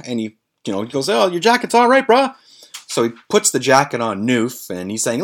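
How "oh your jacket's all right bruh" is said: high-pitched mock-reassuring friend; imitated reassurance. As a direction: brush the damage off in a high voice. Imitates Tone brushing off the damage.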